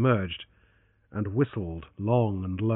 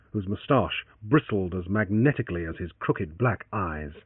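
ordinary delivery, nothing marked

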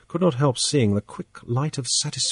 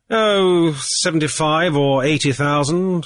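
careless; 6 semitones higher, 3 dB louder than usual. A narrator's reading. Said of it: "Hall said carelessly".

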